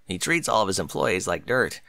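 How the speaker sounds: low and tired, griping about a bad boss. Colt telling a mare about his awful boss, mildly low and glum.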